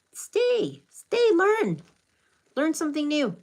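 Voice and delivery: mock pleading, pitched well up; playful pleading. Playfully begs viewers to stay.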